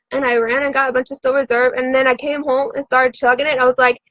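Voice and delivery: ordinary talk, nothing marked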